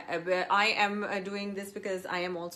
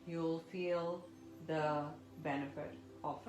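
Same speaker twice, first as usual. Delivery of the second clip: soft spa whisper; 3 semitones lower, 9 dB quieter than usual. Acted. Guides breathing exercise softly.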